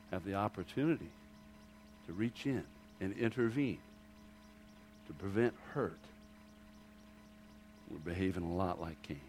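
low, very soft, solemn delivery; hushed preaching. Acted. Preacher warning the congregation against behaving like Cain, hushed. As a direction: drop right down and let each phrase sink in with long pauses.

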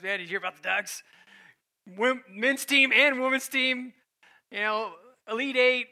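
mock excited sports fan gushing; fired-up friend. Friend Rick raving about the Ducks, imitated fired-up voice. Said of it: "He's pretty fired up".

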